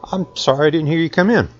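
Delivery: ordinary delivery, nothing marked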